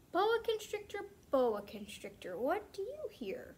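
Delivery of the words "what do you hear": ordinary delivery, nothing marked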